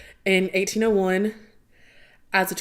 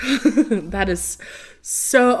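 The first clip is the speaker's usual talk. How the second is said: ordinary delivery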